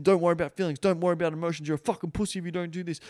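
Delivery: mock alpha pep talk; mocking alpha bro. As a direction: sneer it like a gym-bro guru. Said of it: "It's like".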